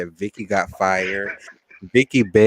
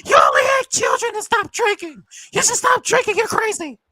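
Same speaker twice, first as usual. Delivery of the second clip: very high, shouted scolding; hammed-up high-pitched attack; mock housewife tirade; 3 dB louder than usual. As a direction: screech it as an outraged reality star. Voicing Vicky's confrontation with a castmate.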